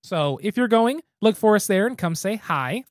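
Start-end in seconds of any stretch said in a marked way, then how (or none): none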